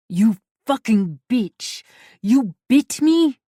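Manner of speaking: slow, loud enraged roar; furious yell. A narrator's reading. Mikhail yells at Valeska after being bitten, furious roar. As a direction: bellow in pain and rage.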